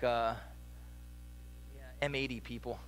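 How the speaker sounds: low, flat, bored mumble; deadpan unimpressed. Pretend. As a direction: sound like the bored crowd that isn't excited. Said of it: "you're more like".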